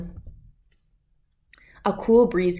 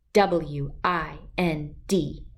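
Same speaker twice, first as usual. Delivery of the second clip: slow letter by letter; spelled out; 3 semitones lower, 4 dB quieter, slower than usual. Shown as a demonstration. Teaching a dog to spell, letter by letter slowly.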